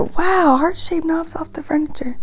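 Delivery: amused whisper. Real, not acted. Parent whispering an aside to the listener, amazed. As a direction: murmur it to the listener in wonder. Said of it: "unvoiced whisper aside".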